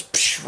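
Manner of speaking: quick "psh" sound effect; imitated spear whoosh. Telling how the spear falls through him, imitated impact noise. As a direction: mimic a falling spear with a hissed psh.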